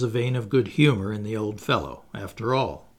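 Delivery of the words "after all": ordinary delivery, nothing marked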